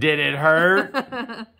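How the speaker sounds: high, very stretched punchline; milk the pickup line with mock concern; over-the-top cheesy wooing; hammed-up flirt. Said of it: "This potion turned you into another person".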